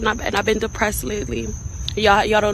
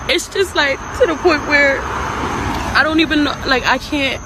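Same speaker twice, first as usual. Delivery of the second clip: high, sobbing, halting despair; real crying; 8 semitones higher, slower than usual. Real, not acted. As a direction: struggle to get words out through sobs. Crying confession to fans.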